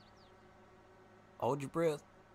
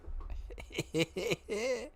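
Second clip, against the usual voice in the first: high laugh; 10 semitones higher than usual. Real, not acted. After trouble.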